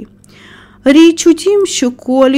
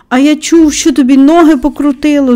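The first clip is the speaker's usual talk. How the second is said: louder, higher tactless retort; blurted out; 5 semitones higher, 5 dB louder than usual. A narrator's reading. Old gardener bluntly challenging the boy, blurting. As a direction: blurt out the rumour. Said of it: "знов бовкнув Бен".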